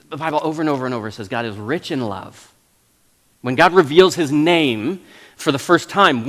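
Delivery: ordinary delivery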